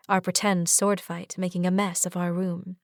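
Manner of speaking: ordinary delivery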